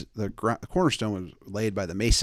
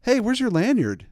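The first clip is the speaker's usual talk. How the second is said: suspicious crew; 10 semitones higher than usual. Retold or imitated. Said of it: "Or like".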